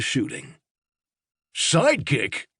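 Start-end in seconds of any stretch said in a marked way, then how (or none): 1.5-2.5 outraged at being called a sidekick; indignant cry. A narrator's reading. Robot protesting the alien's insult, indignant cry. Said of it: "Highbeam cried".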